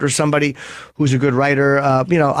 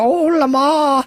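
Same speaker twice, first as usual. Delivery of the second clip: put-on high, drawn-out swoon in an old-lady voice; mother swooning over Lamar, high and drawn out; Dolores Chipperson voice; 10 semitones higher, much slower than usual. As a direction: sigh his name, high and slow. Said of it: "you can kinda hear Dolores under there".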